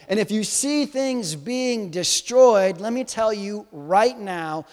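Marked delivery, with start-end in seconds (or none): none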